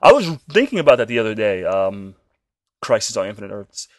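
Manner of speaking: ordinary talk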